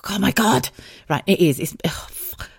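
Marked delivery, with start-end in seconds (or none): 1.6-2.5 real tearful whisper, very soft; choking up, voice almost gone; about to cry. Real, not acted. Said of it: "I think I'm about to start crying".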